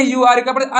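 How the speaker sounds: ordinary talk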